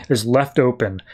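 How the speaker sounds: normal talking voice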